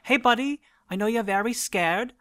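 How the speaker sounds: high, gentle coaxing to a scared animal; soothing talk to a wolf. Acted. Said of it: "I'm just gonna start saying".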